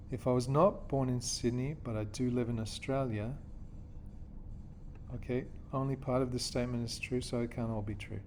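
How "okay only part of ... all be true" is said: ordinary talk, nothing marked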